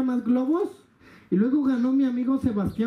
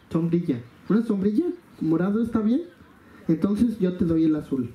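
usual speaking voice

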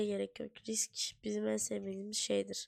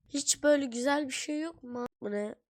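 ordinary talk

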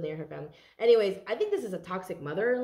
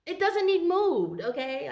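nagging mother; 8 semitones higher, 4 dB louder than usual. Retold or imitated. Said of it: "I'm hearing somebody's like".

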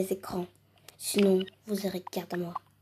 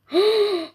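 high gasp of surprise; loud gasp; 10 semitones higher, 8 dB louder than usual. Pretend. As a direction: gasp in amazement. Sees another gift.

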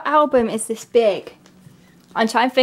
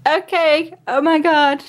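excited; 5 semitones higher than usual. Real, not acted. Unwraps and sees the gift.

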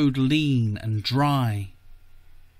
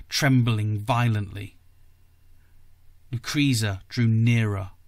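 ordinary delivery, nothing marked

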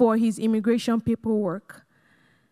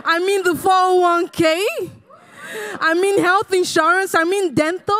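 higher and louder, bursting with excitement; excited; 6 semitones higher, 4 dB louder than usual. Real, not acted. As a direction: rave about each benefit. Excited listing job benefits.

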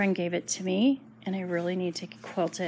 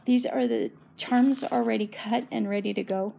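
ordinary talk, nothing marked

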